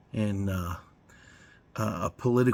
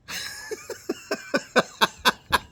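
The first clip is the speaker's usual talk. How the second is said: squeaky giggle; 3 dB quieter than usual. Real, not acted. Laughing at own ad impression.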